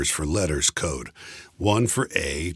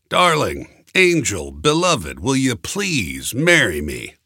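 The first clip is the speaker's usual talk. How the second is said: louder, slow, pleading endearments; earnest romantic plea, slowing on marry me; sweet proposal; 6 semitones higher, 5 dB louder than usual. A narrator's reading. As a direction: lay the sweetness on thick. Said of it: "Try and say it sweetly".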